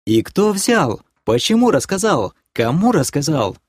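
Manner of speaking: eager, high-pitched interrogation; rapid-fire questioning. A narrator's reading. Girlfriend showering him with questions, agitated. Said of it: "Вопросы так и посыпались".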